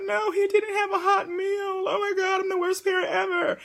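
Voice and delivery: high, wailing mock despair; hammed-up panicked mother. Mother retelling freaking out over no hot meal, high wail.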